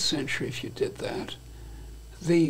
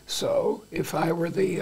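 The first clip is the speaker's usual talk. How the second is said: usual speaking voice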